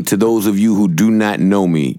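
ordinary talk

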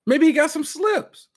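high, drawn-out mock suggestion; slow, squeaky-high put-on voice; puppet-like voice. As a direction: pitch it way up and stretch it. Repeats line in high voice.

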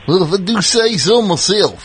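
mock Southern accent, laughing. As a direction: send up his new Southern twang. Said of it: "That sounded".

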